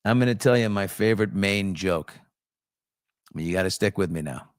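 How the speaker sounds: ordinary talk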